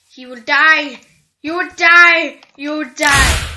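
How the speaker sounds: loud, punchy death threat; loud gamer war cry; menacing game shout. Real, not acted. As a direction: shout it at your target, hitting the last word. Taunting a target in game.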